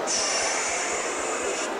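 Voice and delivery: drawn-out unvoiced "shhh"; long shush. Real, not acted. Host to noisy audience before the poem, long shush. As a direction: hush the crowd with one long hiss of breath.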